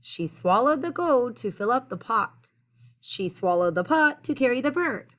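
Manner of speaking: lively storytelling, climbing high on the last line; building rhyme read-aloud. A narrator's reading. Reader carrying children through the repeated rhyme, building read-aloud.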